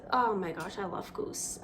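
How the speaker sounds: a breathy, voiceless whisper of love; soft confession about food; whispered adoration. Real, not acted. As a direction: hush it like a guilty pleasure. Gushing over the goose.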